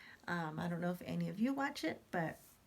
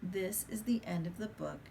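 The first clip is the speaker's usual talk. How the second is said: usual speaking voice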